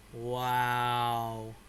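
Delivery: ordinary talk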